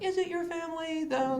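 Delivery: high, squeaky mocking question; mock skeptical. Comedian mocking the senator's claim, high and skeptical. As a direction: needle her with a high incredulous voice.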